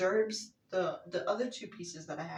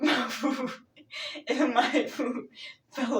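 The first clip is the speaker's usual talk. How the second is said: slowed, louder, laughing on the words; 4 semitones higher, 8 dB louder, slower than usual. Real, not acted. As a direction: laugh through it. Laughing mid-sentence.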